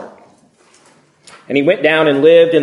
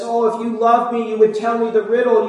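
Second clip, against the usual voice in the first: higher, coaxing plea of a new bride; pleading wife; 7 semitones higher than usual. Retold or imitated. The bride begging Samson for the riddle's answer, coaxing plea. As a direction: play on his heartstrings, lightly voiced. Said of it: "and she pleads him".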